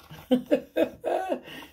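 bright high laugh. Real, not acted. Reader laughs after story.

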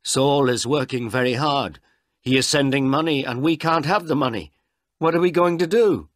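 high, loud, distressed complaint; upset, wailing worry; very unhappy. A narrator's reading. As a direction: lament the trouble, ending in a plea. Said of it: "Martha was very unhappy".

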